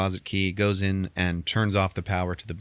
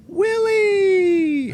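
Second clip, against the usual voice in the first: very high, loud shout of the name; 9 dB louder than usual. Retold or imitated. Thurman calling to Billy Bob before the bullet, very high loud shout. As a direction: cry out to stop the shot. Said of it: "Thurman Merman just goes".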